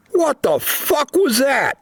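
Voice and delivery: high-pitched panicked yell. Acted. Miller yelling at the squad after the creature attack, high and alarmed. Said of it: "Miller yelled".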